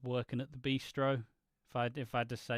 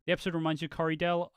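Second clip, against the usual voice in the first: usual speaking voice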